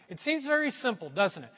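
ordinary talk

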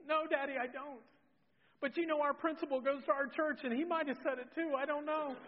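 high-pitched imitated child voice; plaintive mock-tearful excuse, pitched well up; upset little girl. Daughter explaining the principal might have said it, plaintive child voice. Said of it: "and she's crying".

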